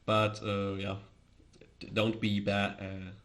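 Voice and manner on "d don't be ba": ordinary delivery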